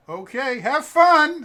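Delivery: very high, loud sing-song goodbye; mock farewell. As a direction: gleefully wave him off. Clerk waves off the customer.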